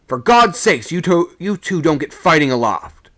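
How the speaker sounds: high, loud desperate plea; a frightened sailor shouting a plea up the mast; alarmed shout. Acted. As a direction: beg them to stop fighting. Pleading shout up the rigging.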